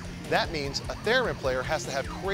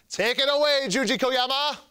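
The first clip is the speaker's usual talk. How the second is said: high, louder announcer flourish; showy hand-over; 9 semitones higher, 3 dB louder than usual. Acted. Host handing over to a musician, showy announcer call. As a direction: throw it over to the performer, pitched up.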